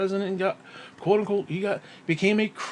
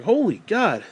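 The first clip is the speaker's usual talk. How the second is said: ordinary delivery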